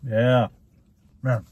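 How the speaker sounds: chewing between words; talking while chewing. Real, not acted. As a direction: mouth partly full of food. Seen 2: Bit quesadilla just before; lips pursed, jaw working during words.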